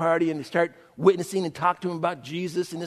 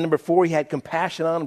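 ordinary talk, nothing marked

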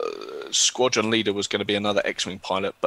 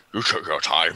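a hint of an alien officer voice, a touch lower; lightly voiced Mon Calamari; 4 semitones lower than usual. Shown as a demonstration. GM tries a Mon Cal voice on the late pilots. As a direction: try out a gruff old alien commodore. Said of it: "shall I try a Mon Cal voice?".

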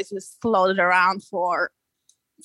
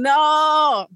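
loud, higher yelled warning; retold yell; 6 semitones higher, 5 dB louder than usual. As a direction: shout the no at a surfer paddling in. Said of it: "he was yelling".

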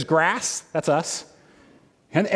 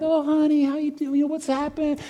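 tender comforting; 9 semitones higher than usual. Retold or imitated. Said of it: "I'm like".

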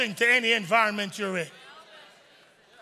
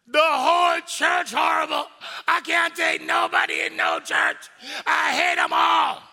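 loud, higher, slow hammed-up tirade; mock outraged yell; 5 semitones higher, 4 dB louder, slower than usual. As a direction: storm out ranting at everyone. Storming out after church hurt.

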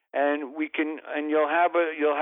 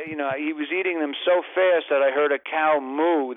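normal talking voice

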